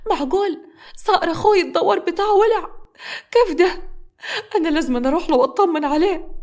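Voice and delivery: high, urgent pleading woman's voice; panicked young wife. A narrator's reading. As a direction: beg him, frantic for your brother. Sister begging husband, panicked.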